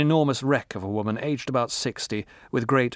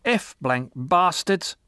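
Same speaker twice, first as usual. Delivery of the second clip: put-on slow, higher drunken yell; 7 semitones higher, 4 dB louder, much slower than usual. A narrator's reading. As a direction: bellow it at the guards. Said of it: "with a yell of".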